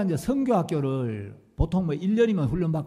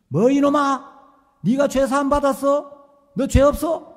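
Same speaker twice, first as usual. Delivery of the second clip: loud, higher, furious bellow; thundering rebuke; 9 semitones higher, 9 dB louder than usual. Retold or imitated. Pastor thundering at the student over his sins, furious bellow. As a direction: blast him with sudden wrath. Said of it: "갑자기 막 호통을 치시면".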